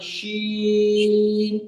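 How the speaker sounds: शीन stretched long and even; slow model letter. Shown as a demonstration. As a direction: model शीन very slowly for the class. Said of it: "इसको तीनों लोग अलग-अलग पढ़िए". (धीरे खिंचा हुआ शीन)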